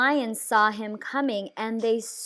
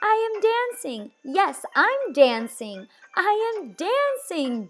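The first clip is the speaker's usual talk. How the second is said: joyful cry; 9 semitones higher than usual. A narrator's reading. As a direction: marvel at dancing at last. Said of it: "Gerald cried".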